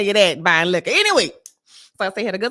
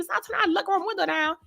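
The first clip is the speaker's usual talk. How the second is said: ordinary talk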